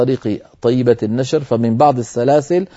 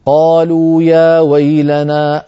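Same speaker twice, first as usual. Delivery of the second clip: Quran recitation; 3 semitones higher, 4 dB louder, much slower than usual. Shown as a demonstration. Teacher reciting the Ya-Sin verse. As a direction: intone it as recitation.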